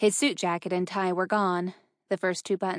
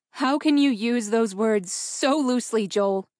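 hurt, mildly raised reproach; tearful anger; 2 semitones higher, 4 dB louder than usual. A narrator's reading. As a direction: reproach him, holding back angry tears. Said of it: "angry tears that wanted to fall".

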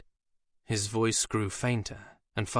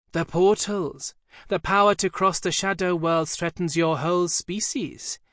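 put-on lightly higher, know-it-all girl's voice; piping demon voice; 6 semitones higher, 4 dB louder than usual. A narrator's reading. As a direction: recite definitions like a pert child. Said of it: "a clear piping voice emerged".

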